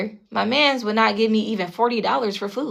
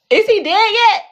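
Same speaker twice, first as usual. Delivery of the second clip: mocking; 11 semitones higher, 6 dB louder than usual. Real, not acted. Mocking question after laugh.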